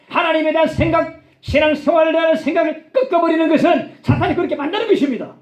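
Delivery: high-pitched, impassioned sermon delivery; ringing pulpit exhortation; forceful preaching. Real, not acted.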